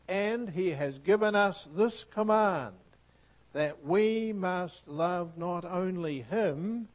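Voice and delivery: loud, slow preached reading; earnest, stately sermon reading; fervent scripture reading. Acted. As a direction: proclaim each line to the congregation. Preaching scripture at full force.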